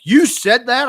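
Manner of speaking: high, loud disbelieving "You said that?"; shocked wife. Retold or imitated. Wife hearing the Titanic story, shocked and loud. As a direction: gasp it out in disbelief. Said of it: "she was like".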